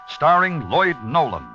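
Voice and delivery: ordinary talk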